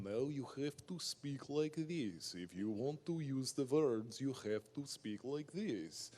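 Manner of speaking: put-on slow, heavy Russian accent. Language tape teaching an actor, hammed-up Russian accent. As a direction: imitate a Russian accent coach, drawn out. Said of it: "If you can do a Russian accent".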